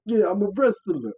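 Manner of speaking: high, loud, slowed brag; hammed-up proud declaration; mock boasting. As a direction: puff it up, imitating his brag. Imitates the man boasting.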